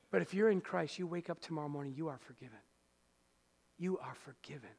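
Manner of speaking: quiet pastoral comfort; tender assurance. Acted. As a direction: gently lay it on their hearts.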